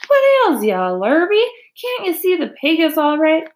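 high, loud exasperated questions; bellowed scolding. A narrator's reading. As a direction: scold a bungling helper loudly. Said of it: "bellowed Mister Zuckerman".

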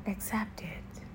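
breathy whisper of the mantra. Shown as a demonstration. Urging the listener to accept, whispered. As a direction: let it fade to a whisper. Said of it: "repeating mantra, whispered last time".

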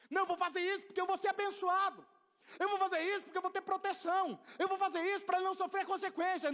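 mock earnest striver; quoting Job. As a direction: play the anxious striver. Job's inner voice quoted.

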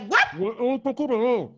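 garbled, as if through a mask; mock masked speech, words smudged together; muffled by a mask. Shown as a demonstration. Demonstrating to co-host talk through a mask, muffled. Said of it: "este programa con tapaboca".

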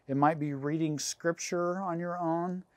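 ordinary delivery

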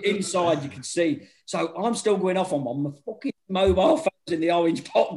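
usual speaking voice

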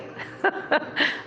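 breathless giggle, way up high; unvoiced high cackle; high airy laugh. Real, not acted. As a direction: laugh silently at the memory. Laughing at doctor story.

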